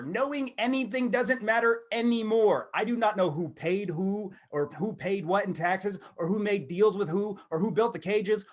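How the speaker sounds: ordinary talk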